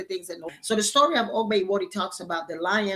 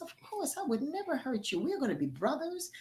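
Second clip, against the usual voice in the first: high, softer and sugary, a false promise; sweet-talking lion; 6 semitones higher, 7 dB quieter than usual. Acted. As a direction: coax him with a high, soft voice.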